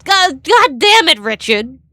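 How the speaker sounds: loud exasperated curse; frustrated outburst. Real, not acted. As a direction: blurt it out, fed up. Streamer frustrated at text-to-speech.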